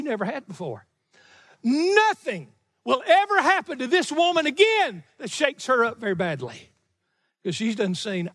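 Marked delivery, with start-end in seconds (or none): 1.6-6.7 high, emphatic preacher's declaration; forceful preaching. Real, not acted. Preacher assuring congregation about the widow, forceful. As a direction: declare it high, punching the key words.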